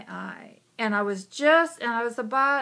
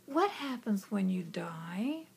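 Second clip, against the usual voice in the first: put-on slow, softer childlike question; hushed question; 7 dB quieter, slower than usual. Girl in bed softly asks God. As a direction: ask it quietly and slowly.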